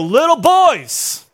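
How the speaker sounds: high, loud, drawn-out dad's holler; calling out. Retold or imitated. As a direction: stretch it out to cut through the noise. Father getting fighting sons' attention.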